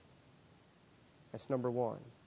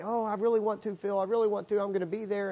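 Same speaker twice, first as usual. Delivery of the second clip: lightly voiced young man, pitched up; a higher, mildly eager retold promise; eager promising; 7 semitones higher, faster than usual. Young man assuring the preacher he'll change, mildly eager.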